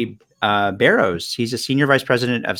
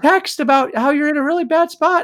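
very high, louder mock-distraught voice; hammed-up, pitched-up tearful wife; imitating crying wife; 5 dB louder than usual. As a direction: act out an upset wife explaining.

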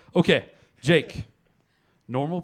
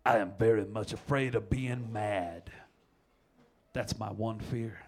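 slow dramatic read; 4 semitones lower, 6 dB quieter, slower than usual. Acted. Reading quote slowly.

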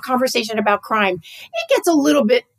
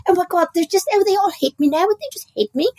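higher, hammed-up overstatement; lightly camp British dramatics, pitched up; playfully over the top, higher; mock British exaggeration; 7 semitones higher than usual. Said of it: "playful exaggeration humor".

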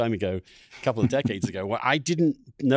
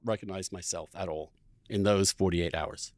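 ordinary talk, nothing marked

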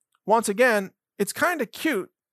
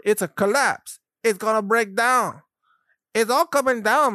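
ordinary talk

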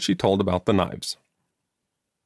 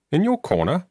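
a high, questioning echo of her words; mother echoing her daughter, mildly surprised; 10 semitones higher than usual. Acted. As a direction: repeat it back, caught off guard. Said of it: "repeated in surprise".

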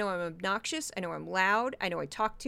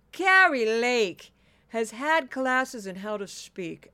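slow, over-enunciated politician impression; Kari Lake; 5 dB louder, slower than usual. Shown as a demonstration. Podcaster showing how a politician talks, drawn-out mocking impression. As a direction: stretch it out like a speech-class graduate. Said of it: "'Cause that's my Kari Lake".

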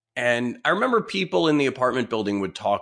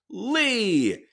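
high, happy call of his name; surprised greeting; 11 semitones higher than usual. Retold or imitated. Neighbor recognizing him in the elevator, high glad call. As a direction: answer with the same delighted surprise.

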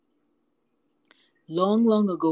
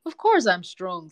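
slow, louder, mildly boastful; proud; 7 dB louder than usual. A narrator's reading. Cheetah answers the admiring cat, proud. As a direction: state it with measured pride, taking your time. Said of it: "said Duma proudly".

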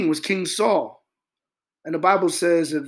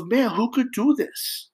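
higher, slow amazed king; slow, wondering Pharaoh; 6 semitones higher than usual. Retold or imitated. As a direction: marvel at it slowly. Pharaoh marvels.

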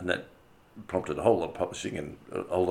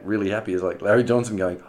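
ordinary talk, nothing marked

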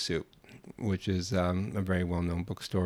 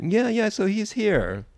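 insistent Italian woman; 13 semitones higher, 5 dB louder, slower than usual. Retold or imitated.

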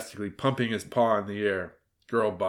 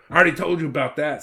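fast, loud, raised reprimand; scolding; 6 semitones higher, 7 dB louder, much faster than usual. A narrator's reading. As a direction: snap it out impatiently. Red scolds the wolf.

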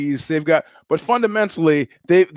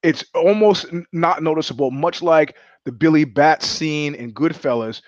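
ordinary delivery

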